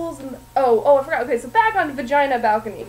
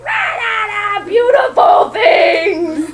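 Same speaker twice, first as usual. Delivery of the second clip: higher, louder, slow monster roar; mock angry song; 8 semitones higher, 10 dB louder, much slower than usual. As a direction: roar it like a scary organ song. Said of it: "very angry, scary song".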